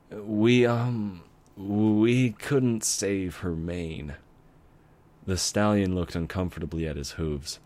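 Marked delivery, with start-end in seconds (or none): 0.1-4.1 slow, halting, apologetic; hesitant bad news. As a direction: break the news slowly and reluctantly. Doctor delivering bad news.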